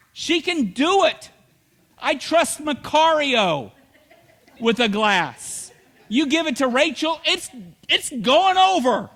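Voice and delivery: ordinary delivery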